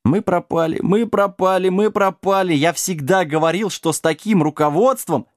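high-pitched wailing little dog; hammed-up high whine of doom; squealing panic. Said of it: "Прекрати визг, негодник".

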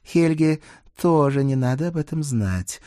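careful request. A narrator's reading. Dana asks him to keep it from Helga, cautiously. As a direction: ask gingerly, drawing the words out. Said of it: "осторожно попросила Дана".

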